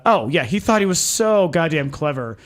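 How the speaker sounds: ordinary delivery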